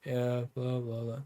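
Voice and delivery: flat, bored, dull mumble; the uninspiring shotcaller voice; boring monotone. Shown as a demonstration. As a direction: drone it like you don't care. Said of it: "where you're just going".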